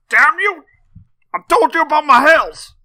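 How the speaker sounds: put-on high, loud old-timer outrage; Prospector. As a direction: holler at a trespasser like an old-timey coot. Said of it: "I'm gonna go up into his hills".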